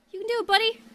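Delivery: high, eager cheer; encouraging. Acted. Agent urging Klutzy on, high encouraging voice. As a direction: cheer on a little buddy.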